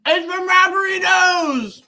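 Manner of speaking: high, loud proud shout; excited shout. Real, not acted. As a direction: proudly announce it with a full mouth. Proud shout of the brand name.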